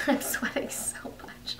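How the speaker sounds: hushed whispered aside, breath only. Real, not acted. Telling her story, whispers the sweaty detail. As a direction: drop to a whisper as if confessing. Repeats the line, then whispers it.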